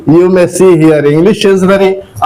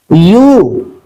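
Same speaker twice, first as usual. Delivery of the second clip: ordinary talk, nothing marked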